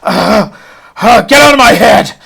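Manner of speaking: high, loud shout breaking free; defiant shout. Acted. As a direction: throw the voice out of your head. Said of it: "he just shakes it off and says".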